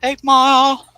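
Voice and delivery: very high, loud announcer yell; hype callout. Acted. Host hyping Eight Mile in a high loud call. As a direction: call it out like a rap battle.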